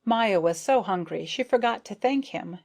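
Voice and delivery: ordinary delivery, nothing marked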